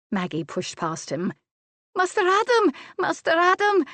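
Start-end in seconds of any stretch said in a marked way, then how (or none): 2.0-3.8 high, loud calling out; urgent call. A narrator's reading. Maid calls through the house for her master, urgent. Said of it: "she called urgently".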